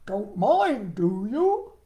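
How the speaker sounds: slow, loud croaky frog voice; a big eager frog, booming; Froggy voice. Acted. Froggy voice continues.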